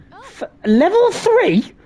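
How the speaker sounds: high, stunned disbelief; surprised. Real, not acted. Streamer sees hype train hit level three, surprised. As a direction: jump up in real surprise.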